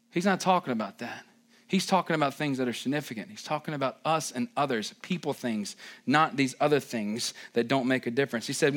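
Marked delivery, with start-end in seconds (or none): none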